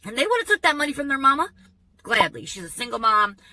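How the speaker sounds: ordinary talk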